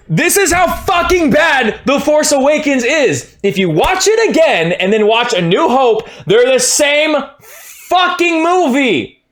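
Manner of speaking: high shouting rant, near screaming; furious fan yelling; heated rant. Real, not acted. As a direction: rage at the screen. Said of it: "I'm getting really heated".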